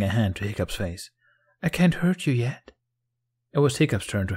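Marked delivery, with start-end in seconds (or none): 1.6-2.7 high, teasing threat; taunting queen. A narrator's reading. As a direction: threaten sweetly in a raised voice. Snow Queen threatens Hiccup.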